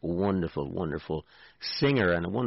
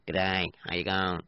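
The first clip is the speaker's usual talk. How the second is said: put-on Australian greeting; mock Australian accent; Aussie accent. Shown as a demonstration. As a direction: do an Aussie accent with a froggy twang. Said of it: "I can do an Aussie one".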